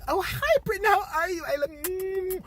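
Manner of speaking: high, sugary gushing greeting; gushing fake friend. America greeting Britain, high sugary gush.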